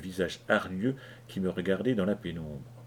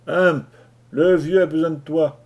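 loud call down the hatch; 6 semitones higher, 8 dB louder than usual. Acted. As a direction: shout it down through the hatch. Appela par l'écoutille. (appel fort par l'écoutille)

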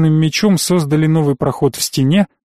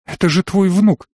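mild sob, pitched up; a hint of tearful pleading; sobbing; 5 semitones higher than usual. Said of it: "всхлипнула Таня". (лёгкий намёк на всхлип)

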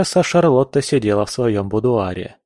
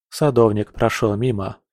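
usual speaking voice

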